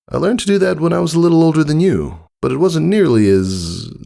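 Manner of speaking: breezy, a bit boastful; friendly older stallion. Acted. Tycho telling Scootaloo about learning it, casually.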